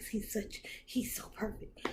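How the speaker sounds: soft breathy whisper of awe; dreamy whisper. Retold or imitated. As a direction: whisper it, lovestruck. Inner thought, whispered.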